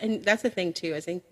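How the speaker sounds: ordinary delivery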